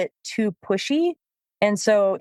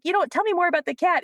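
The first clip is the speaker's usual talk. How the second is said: much higher, quick chatty interest; mock fascination; 9 semitones higher, faster than usual. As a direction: keep ignoring the dog on purpose. Feigned interest ignoring dog.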